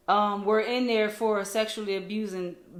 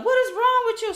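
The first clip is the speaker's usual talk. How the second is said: high, raised mock yell; imitated yelling; 13 semitones higher, 6 dB louder than usual. Stranger comes over to confront the mother, imitated yelling. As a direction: mimic an outraged woman butting in. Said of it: "She comes over yelling".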